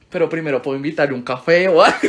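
ordinary talk

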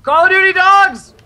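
high, loud alarmed cry; panicked yelling. Real, not acted. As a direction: yell in real panic. Panicked by dogs in game.

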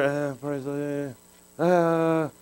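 slow, soft, low weary mumble; worn out, barely getting the praise out; tired half-hearted praise. Acted. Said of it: "I'm tired tonight".

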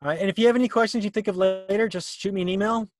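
usual speaking voice

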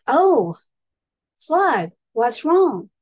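high, loud alarmed exclamation; startled. A narrator's reading. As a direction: react with sudden concern. Snail reacts to startled Slug.

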